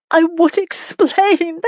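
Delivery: high, slow, tearful plea; sobbing. A narrator's reading. Wife sobbing to her angry husband. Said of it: "she sobbed".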